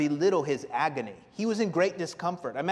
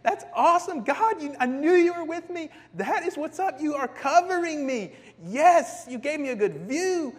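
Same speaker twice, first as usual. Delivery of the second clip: high, loud, giddy elation; 11 semitones higher, 8 dB louder than usual. Acted. Preacher playing Jonah delighted by the plant, giddy. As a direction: crow with delight like a thrilled kid. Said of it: "Jonah is just giddy. He's like".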